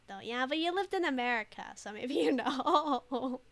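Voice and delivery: ordinary talk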